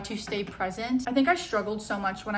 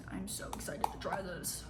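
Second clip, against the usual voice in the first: soft excited whisper; 3 semitones lower, 11 dB quieter than usual. Real, not acted. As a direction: breathe it out, barely voiced. Aside while getting ready.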